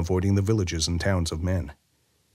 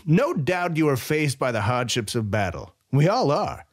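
higher, mildly amused commander; amused general; 6 semitones higher than usual. A narrator's reading. General laughing off Weyra's complaint, higher voice. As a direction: brush off his hardships.

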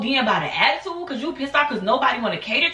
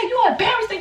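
very high, laughing dare; mock challenge; 12 semitones higher than usual. Speaker daring her friend to fight, high and laughing.

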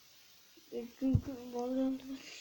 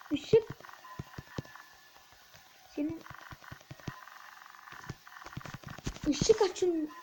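panicked shout; 3 semitones higher, slower than usual. Real, not acted. Player frantically demanding the light, shouting high.

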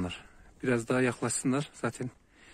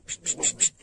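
psst calling. Real, not acted. Shepherd coaxing strange puppies toward him, breathy psst calls. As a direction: hiss short calls to coax puppies closer.

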